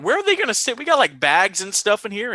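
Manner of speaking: high, disbelieving complaint; mock high outrage about the crowded car; incredulous squeak. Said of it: "I'm like".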